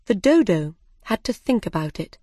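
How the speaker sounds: usual speaking voice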